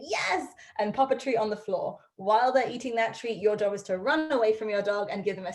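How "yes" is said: high, bright-eyed marker for a dog; high-pitched, upbeat training cue; marker word praise. Shown as a demonstration. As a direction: mark the moment with a high yes. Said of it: "then you're gonna say".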